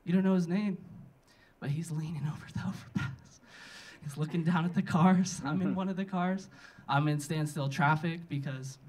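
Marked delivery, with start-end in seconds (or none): none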